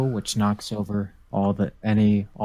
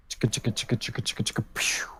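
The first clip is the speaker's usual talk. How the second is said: soft whispered chicka-chicka, then a pew; quiet mouth-made rattle and launch; imitated dice rattle; 7 dB quieter, much faster than usual. Player mimics rolling the dice, soft rattling sound effect.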